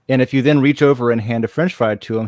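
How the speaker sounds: normal talking voice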